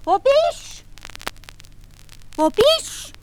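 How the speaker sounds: very high, far-off echoing call; Geisterstimme. A narrator's reading. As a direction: echo the question back thinly. Stimme ruft zurück.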